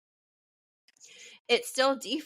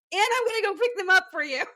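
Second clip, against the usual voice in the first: high, fast mock-exasperated quote; retold exasperation; 11 semitones higher, much faster than usual. Partner telling her the crab cake errand proves love, mock exasperated. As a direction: imitate a put-upon partner at a raised pitch.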